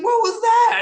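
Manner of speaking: high shouted mock outrage. Retelling how Abdullah yelled after a stiff punch, mock high shout. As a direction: yell it like a big man just got clocked.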